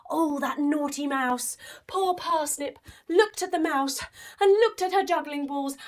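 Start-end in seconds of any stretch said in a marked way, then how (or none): none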